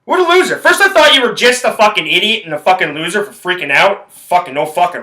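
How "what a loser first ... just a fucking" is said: scornful inner thought. Retold or imitated. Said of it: "I'm like".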